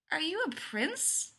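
put-on high, slow, tentative child; uncertain. A narrator's reading. Joe questioning the strange man, uncertain. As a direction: ask shyly in a small high voice. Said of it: "Joe asks the man uncertainly".

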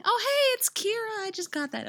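very high surprised squeal settling down; high-pitched, pleased surprise; delighted realisation. Real, not acted. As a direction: exclaim high as it dawns on you. Sudden realisation during reading.

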